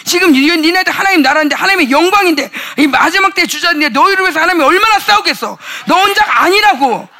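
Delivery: loud, high fervent preaching; preaching at full force. Real, not acted. Preacher rousing the congregation, loud fervent preaching. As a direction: pound it out to the congregation.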